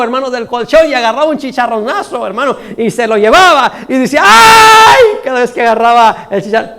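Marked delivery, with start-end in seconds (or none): 4.2-5.2 high, loud, drawn-out cry; mock widow's shriek. Widow sees the cat steal her food, mock shriek. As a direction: let out a hammed-up yelp of grief. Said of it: "pegaba a la mujer el grito".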